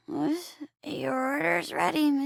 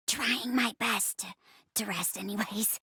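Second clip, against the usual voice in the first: soft, voiceless whisper; vocal-rest whisper; 3 semitones lower, 6 dB quieter than usual. Acted. Actor on vocal rest to a visitor, whispering. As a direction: whisper to spare the voice. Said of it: "I'm on vocal rest right now".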